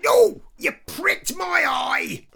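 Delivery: a melodramatic anguished outcry over a pricked eye; mock dramatic cry. Mock dramatic segment jingle.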